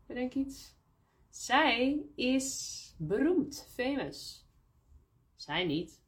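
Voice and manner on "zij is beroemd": very slow, zij lifted high; stress on zij. Shown as a demonstration. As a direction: dwell on zij as the famous one. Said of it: "Of zij". (zij langzaam en hoog benadrukt)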